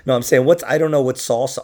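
ordinary delivery

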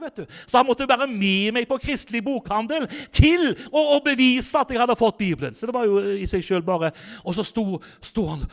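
ordinary talk, nothing marked

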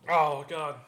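groaning at the hot sauce; pained burning groan; mouth burning from spicy food. Real, not acted. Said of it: "It comes right back".

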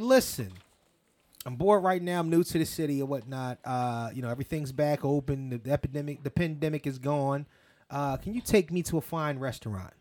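soft, low, smooth man voice; lightly voiced smooth rich guy; smooth low-key talker.

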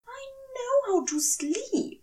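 high, indignant insistence; insisting. Acted. Aziraphale insisting to Crowley he can sleep, indignant. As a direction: protest it, a touch offended. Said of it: "Aziraphale insisted".

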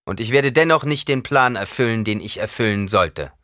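loud, high-pitched public proclamation. A narrator's reading. Paul addressing his comrades about the Reverend Mother, loud. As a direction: call it out to everyone present. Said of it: "Lauter sagte Paul".

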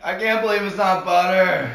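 loud, high commercial slogan; TV ad pitch. Pretend. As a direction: sell it like a TV spokesman. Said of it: "Tell your fans, come on".

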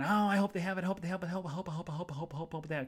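high, rapid breathless-style chant of hope; anxious hoping. Retold or imitated. Library visitor wishing to herself for the movie, anxious hoping. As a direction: race through it like a frantic wish. Frantic hoping in her head.

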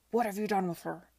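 loud, abrupt angry demand; shouted demand. A narrator's reading. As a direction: burst in shouting, no preamble. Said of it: "the former shouted without preamble".

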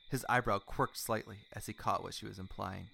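ordinary delivery

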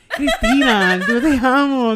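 laughing, hammed-up fan gush; laughing mock declaration. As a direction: giggle out a fan's confession of love. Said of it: "Casi todo el mundo es como que".

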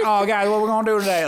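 sweet Alabama mom put on, Southern; Southern impression. Friends mimic a Southern mom, mock Southern accent. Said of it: "if you could bottle Alabama in a voice".

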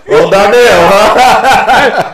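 high, loud teasing through laughter; cracking up at the name; laughing tease. Real, not acted. Friend teasing about the name Darnell, laughing high and loud.

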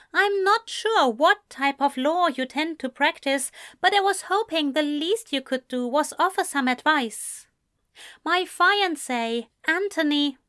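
put-on poised young man insisting politely; lightly voiced Ezra. Ezra explaining to Lucas.

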